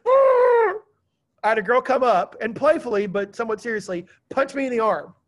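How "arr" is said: high, loud frustrated "Arr!" roar; mock groan of the kids. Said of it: "they go".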